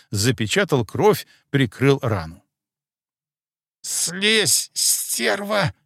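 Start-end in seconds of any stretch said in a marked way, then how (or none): 3.8-5.7 high hissing cat-demon voice. Acted. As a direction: hiss it out like a cornered cat. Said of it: "прошипела кошка".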